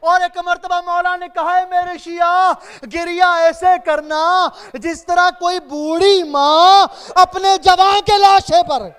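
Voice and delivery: loud, high, wailing majlis recitation; mourning sermon. Acted. Preacher driving mourners to weep, loud wailing recitation. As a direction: preach the lament with rising grief.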